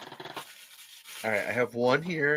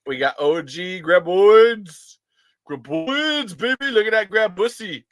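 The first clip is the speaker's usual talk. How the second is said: high, loud, stretched-out whoop; drawn-out giddy cheer; excited showing off; 5 semitones higher, 6 dB louder, slower than usual. Real, not acted. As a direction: crow over a new toy. Showing off new toys.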